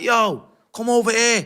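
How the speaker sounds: loud, high call across the wing. Retold or imitated. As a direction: shout them over, high and loud. Calling mates over on the wing.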